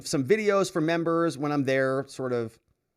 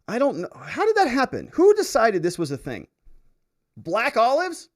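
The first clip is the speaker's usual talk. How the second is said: high, louder, outraged disbelief; incredulous rant; 9 semitones higher, 5 dB louder than usual. Real, not acted. Host demanding who invented the viewer's pizza, in incredulous outrage. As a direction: demand an answer from the heavens. Ranting about the pizza order.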